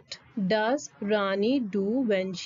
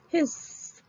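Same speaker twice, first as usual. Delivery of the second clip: snake hiss; 6 semitones higher, 3 dB quieter than usual. Shown as a demonstration. Teacher says snake sound.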